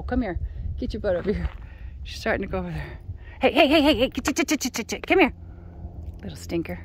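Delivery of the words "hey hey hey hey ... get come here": loud, high calls to a dog; loud calling. Real, not acted. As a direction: shout the dog back. Calling the dog back loudly.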